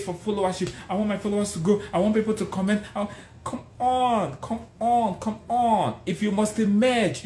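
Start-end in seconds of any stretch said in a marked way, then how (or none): 3.5-6.0 urging. Real, not acted. Urging listeners.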